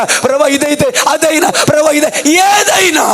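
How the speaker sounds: God's question answered with rising pleas; pleading preacher's climax. Acted. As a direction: press the question, then break into a high cry.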